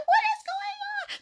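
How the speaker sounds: very high squealed question; mock-bewildered squeal; baffled disbelief. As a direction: retell your shock in a squeaky high voice. Retold shock at packed clubs.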